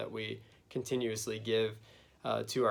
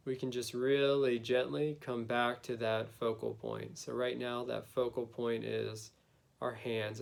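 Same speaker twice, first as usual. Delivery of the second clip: guided meditation. Acted. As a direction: gently lead them inward, unhurried.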